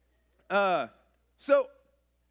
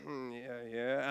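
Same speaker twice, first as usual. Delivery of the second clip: sleepy groan; 9 semitones lower, 9 dB quieter, slower than usual. Retold or imitated. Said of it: "it's like".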